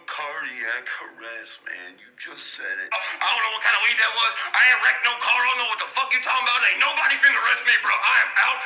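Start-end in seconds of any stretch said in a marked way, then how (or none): 0.1-2.9 much lower, much softer groggy voice; dazed stoner friend mumbling back. Acted. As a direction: mumble it half asleep. Friend answers, muffled and low.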